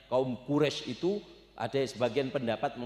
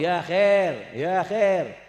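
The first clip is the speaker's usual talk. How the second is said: calling out; 3 semitones higher, 7 dB louder, much slower than usual. Retold or imitated. People calling him.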